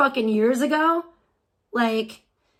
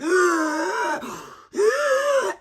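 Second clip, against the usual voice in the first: long, high noisy inhales; mock huffing gasp; 8 semitones higher than usual. Streamer imitating her head in the bag, hammed-up huffing gasps. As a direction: suck air in greedily, exaggerated.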